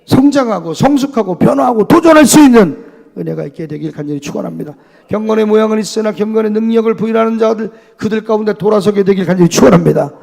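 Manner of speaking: loud, rising preacher's blessing; fiery preaching. Acted. Preacher blessing the congregation in full cry. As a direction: pour it out over the congregation.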